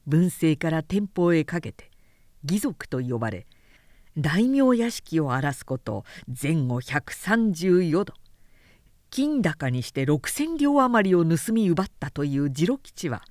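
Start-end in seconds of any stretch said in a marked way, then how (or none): none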